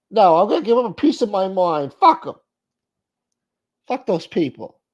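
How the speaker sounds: high, loud comic tirade; hammed-up anger. Host playing an irate caller in a phone skit, mock angry. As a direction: blow up in a high put-on voice. Answering voice in acted phone call.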